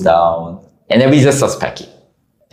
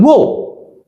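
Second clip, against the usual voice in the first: mock protest; 7 semitones higher, slower than usual. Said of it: "me and the other gamers were like".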